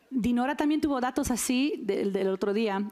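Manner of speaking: ordinary talk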